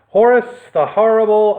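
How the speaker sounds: loud, high announcing of the name; dramatic title. A narrator's reading. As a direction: boom out the villain's name.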